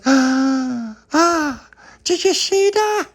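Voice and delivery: very high, loud squeal; shrieky child astonishment; amazed gasps. Acted. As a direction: gasp twice, then squeal slowly. Charlotte amazed at coin trick.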